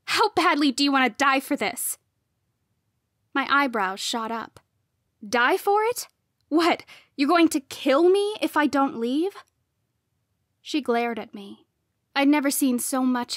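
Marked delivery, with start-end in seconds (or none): 0.0-2.0 higher, louder threat; menacing raised-voice question; harsh. A narrator's reading. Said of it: "she asked harshly". 5.3-9.4 high, loud disbelief; indignant rising questions; incredulous. A narrator's reading. Shocked retort to death threat.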